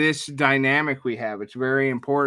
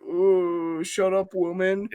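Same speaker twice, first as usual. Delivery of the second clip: higher, playful mock-offended comeback; mock indignation; 6 semitones higher, slower than usual. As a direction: act put out by the teasing. Retells his comeback to teasing wife.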